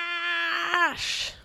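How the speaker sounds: high, drawn-out crash effect; dramatic crash. A narrator's reading. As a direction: stretch it out like the crash itself. Sudden crash in the story.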